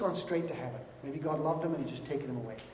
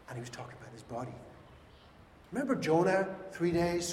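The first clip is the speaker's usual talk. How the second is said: ordinary delivery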